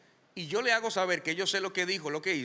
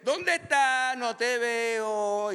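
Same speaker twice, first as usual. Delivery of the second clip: higher, louder mock search; mock lost God; 6 semitones higher, 5 dB louder, much slower than usual. Preacher mocking a God who can't find Adam, louder. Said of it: "No es que Dios le dice".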